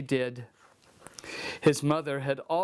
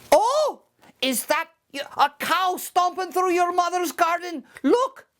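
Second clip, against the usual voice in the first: alarmed leprechaun; 12 semitones higher than usual. Acted. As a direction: fake a panic to trick him. Leprechaun tricks Seamus.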